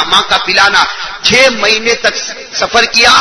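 ordinary talk, nothing marked

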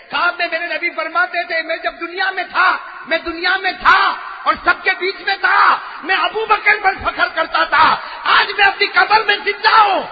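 high, loud preaching peak; fiery sermon climax; climactic shout. Acted. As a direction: rise to a roaring climax. Climax of the quoted dream.

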